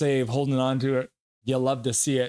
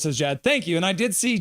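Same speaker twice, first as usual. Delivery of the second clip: ordinary talk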